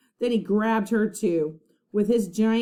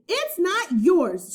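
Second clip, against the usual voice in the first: high, slow, loud protest; angry shout; 10 semitones higher, 5 dB louder, slower than usual. A narrator's reading. Annie shouting at the pirates over the medallion, loud protest. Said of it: "shouted Annie".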